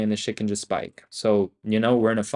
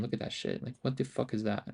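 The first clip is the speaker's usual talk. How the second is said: whispered disbelief; 11 dB quieter than usual. Real, not acted. Stunned at the chart.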